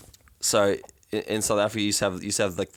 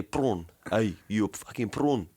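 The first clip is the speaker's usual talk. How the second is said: slow put-on Afrikaans accent; slower than usual. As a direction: do the District 9 Afrikaans accent, taking it slowly. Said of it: "very stereotypical Afrikaans accent".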